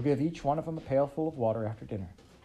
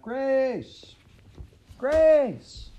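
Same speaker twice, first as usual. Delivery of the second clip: high shout, much louder on the second; 10 dB louder than usual. A narrator's reading. Pa shouting for the lost child, rising to a loud yell. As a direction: bellow the name, growing desperate. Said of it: "He shouted".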